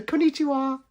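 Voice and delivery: high, sing-song put-on Konnichiwa; playful high "Konnichiwa" echo; mock cutesy greeting.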